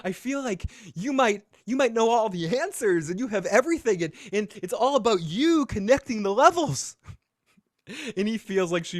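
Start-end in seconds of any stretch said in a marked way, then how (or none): 0.0-7.0 high, hammed-up smooth-talker voice; smooth pickup line. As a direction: lay on the charm like a cheesy player. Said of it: "Macking one oh one".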